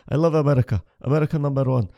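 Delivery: put-on broken-English fan voice with a Middle Eastern accent; light Middle Eastern accent, eager fan. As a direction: gush about America in a Middle Eastern accent. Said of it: "Every time I see a Middle Eastern person".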